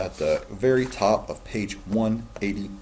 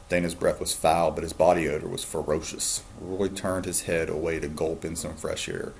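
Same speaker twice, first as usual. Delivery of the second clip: ordinary delivery, nothing marked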